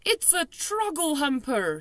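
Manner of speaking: high, slow anguished shout; furious shout. A narrator's reading. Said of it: "he shouted".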